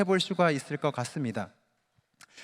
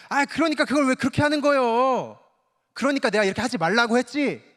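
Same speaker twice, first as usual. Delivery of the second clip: curt, higher reproach; blunt scolding; 6 semitones higher than usual. Retold or imitated. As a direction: snap at someone who made a mistake. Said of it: "퉁명스러운 말투로". (퉁명스럽게 높여 나무라는 말투)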